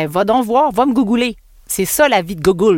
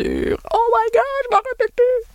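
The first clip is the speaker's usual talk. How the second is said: drawn-out, very high squeaky wail; playful high-pitched whine at forgetting; comic dismay; slower than usual. Real, not acted. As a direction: squeal it slowly as memory fails. Blanks on which episode it was.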